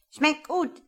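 high, loud bird echo; talking starling. A narrator's reading. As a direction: parrot it back like a starling. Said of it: "sagte der Star".

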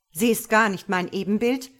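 loud indignant cry; calling out. A narrator's reading. God challenges the angels, calling out. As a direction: call it out in disbelief. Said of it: "ruft Gott".

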